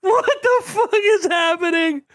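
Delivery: high, slow, stunned cry; reacting to the chaos in disbelief. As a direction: drag it out in shock. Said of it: "comedic timing of".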